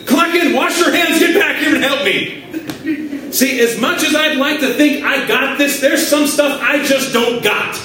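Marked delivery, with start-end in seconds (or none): 0.1-2.4 high-pitched urgent shout; acted-out plea to a late helper, pitched way up; frantic call for help. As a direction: yell hurried orders as if swamped. Acts out calling to arriving team member.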